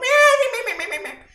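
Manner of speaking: shrill mocking laugh. Real, not acted. Mocking child then laughing.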